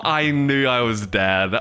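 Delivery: normal talking voice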